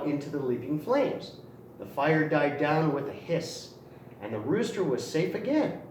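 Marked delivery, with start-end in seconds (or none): none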